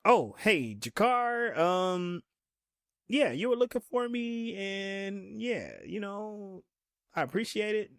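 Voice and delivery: high-pitched awkward backpedaling; Garibaldi stalling awkwardly. Retold or imitated. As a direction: stretch the words out, unsure and placating. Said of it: "Garibaldi is like".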